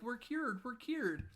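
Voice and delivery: very high, gleeful echo; mock relief. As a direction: mimic two rescued friends in a squeaky-high cheer. Retold pair echoing the cheer.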